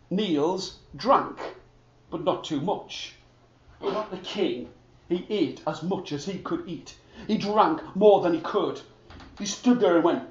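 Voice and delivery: plain reading voice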